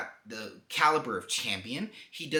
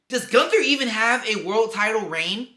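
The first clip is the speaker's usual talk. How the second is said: loud, high, indignant challenge; worked-up rant, pitched up and loud; incredulous outburst; 8 semitones higher, 8 dB louder than usual. Real, not acted. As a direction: demand it loudly in disbelief. Incredulous rant about Gunther's win.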